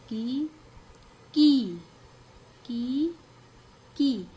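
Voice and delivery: की short then long, alternating. Shown as a demonstration. Teacher to class, alternating short and long की. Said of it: "थोड़ा slow बोलेंगे और इसको थोड़ा लंबा बोलेंगे".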